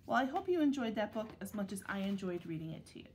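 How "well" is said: plain reading voice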